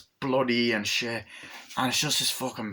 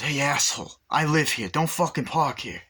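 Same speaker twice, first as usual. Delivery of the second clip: raised-pitch imitated telling-off; mock angry challenge; 5 semitones higher than usual. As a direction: tell them off, acting an irate local. Acts a man confronting them.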